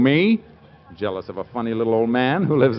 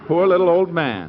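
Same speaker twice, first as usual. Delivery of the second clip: mocking laughter; 7 semitones higher than usual. Husband laughing at the tiny rival's size. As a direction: crow with laughter at the joke.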